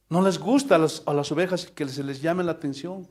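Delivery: normal talking voice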